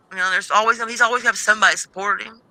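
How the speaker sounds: ordinary talk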